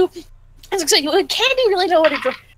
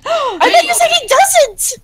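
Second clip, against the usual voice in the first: shocked gasp; 14 semitones higher, slower than usual. Real, not acted. Player caught out, gasping.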